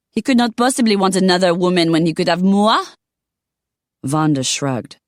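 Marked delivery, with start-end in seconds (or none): 0.1-2.8 put-on an offended Parisian lady with a French accent. Simone boasts in French accent.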